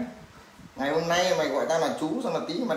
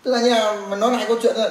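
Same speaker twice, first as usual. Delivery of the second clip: raised, higher voice demanding an explanation; impatient, loud and pitched up; 6 semitones higher, 7 dB louder than usual. Real, not acted. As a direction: push the caller loudly to explain. Demands the caller explain again.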